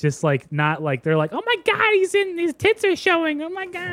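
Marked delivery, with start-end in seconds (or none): 1.3-3.9 very high-pitched mock squeal; immature gawker. Imitating immature people spotting his tits, high mock squeal. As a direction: squeal like a giddy, immature onlooker. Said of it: "I was thinking, like, immaturity".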